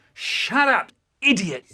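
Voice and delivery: high, mock-annoyed shut up; annoyed retort. Boy answers his brother with a mock-annoyed snap. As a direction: snap back at your brother. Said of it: "And I say".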